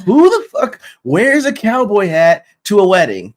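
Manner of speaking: imitating wedding guests; mock guest outrage. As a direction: act out a scandalized guest. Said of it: "They're like".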